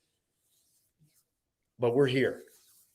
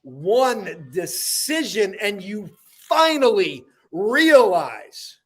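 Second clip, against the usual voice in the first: slow, emphatic spoken-word delivery, high attacks falling away; dramatic poem recital; 7 semitones higher, 5 dB louder, slower than usual. Acted. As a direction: declaim each line, pausing before the last words. Recites own motivational poem.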